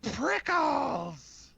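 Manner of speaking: high, drawn-out cry; high-pitched acted wail; screamed name. A narrator's reading. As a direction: scream it as the character calling out.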